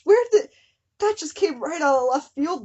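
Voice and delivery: sudden high, loud outburst; real startled outburst; shocked disbelief. Real, not acted. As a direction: caught off guard. Shocked reaction to twist.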